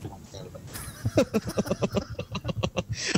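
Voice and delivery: unvoiced laugh; breathy laugh. Real, not acted. Laughing at own impression.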